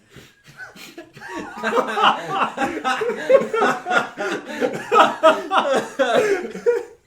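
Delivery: long, loud, high laughing fit; shrieking, sustained belly laugh; uproarious laughter. Real, not acted. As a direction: erupt into helpless laughter. Table erupts at wish.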